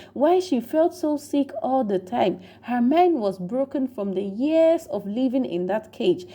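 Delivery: ordinary delivery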